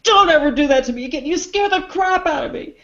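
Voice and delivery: high, indignant mock outburst; shaken scolding. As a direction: scold the prankster who scared you, acted out. Voicing Rudd scolding after prank scare.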